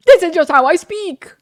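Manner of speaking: loud, high-pitched acted retort; exasperated outburst. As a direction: burst out at your accusers, exasperated. Retort to accent accusers.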